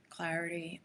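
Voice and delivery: softly whispered word; whispered word in a retold prayer. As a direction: breathe it out as a whisper. Praying to Spirit for what's needed.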